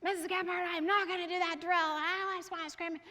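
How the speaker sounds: imitating a pouting boy; angry little boy. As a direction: whine like a mad kid who wants to scrimmage. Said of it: "He's like".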